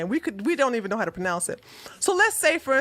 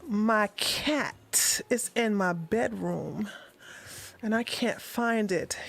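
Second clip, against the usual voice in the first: slow, much softer sultry tease on "my cat is in my bedroom"; seductive purr; 4 semitones lower, 8 dB quieter, slower than usual. Retold or imitated. Friend purring at the officer about her cat, slow and soft. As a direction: linger on "my cat is in my bedroom" like a come-on. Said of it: "she begins to feel herself like".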